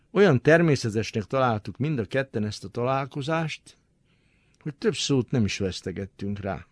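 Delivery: ordinary delivery, nothing marked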